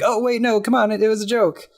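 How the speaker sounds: high-pitched mock protest; imitated kids' high, worried backpedalling; mock pleading. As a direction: plead like kids caught out. Kids pleading with cops about arrest.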